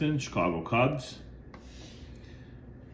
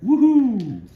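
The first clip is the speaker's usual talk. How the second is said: much higher, much louder whoop; excited woohoo; 10 dB louder than usual. Real, not acted. Collector cheering a blue Sharpie autograph, excited high loud whoop. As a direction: cheer like you hit the jackpot. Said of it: "gonna get a little excited".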